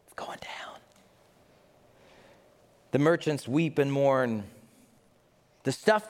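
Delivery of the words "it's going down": hushed ominous whisper; whispered warning of doom. Acted. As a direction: breathe it like a dark secret. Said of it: "Scripture says".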